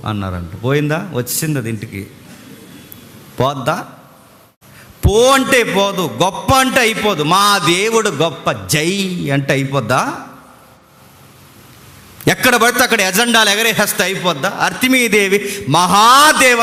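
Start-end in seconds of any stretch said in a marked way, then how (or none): 5.0-14.5 higher and louder, hammering each question; preacher scolding the noisy worshippers; fiery preaching. Real, not acted. Preacher rebukes empty shouting. 14.5-16.6 loud, high, slow mocking cry of "అర్తిమీదేవి Mahadevi"; loud drawn-out mock chant; mock shout. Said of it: "అని అరుస్తున్నారు".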